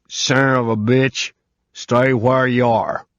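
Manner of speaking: put-on old man with a gun, drawn out and threatening; old southern man. Old homeowner ordering the intruder to stay put, slow southern threat. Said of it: "He sounded an oldish man".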